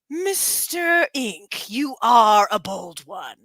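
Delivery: very slow, drawn-out villain voice; General Grievous impression. Greeting a stream viewer in a slow villain voice. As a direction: stretch each word like a menacing cyborg general. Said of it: "Oh, wait. I'm sorry".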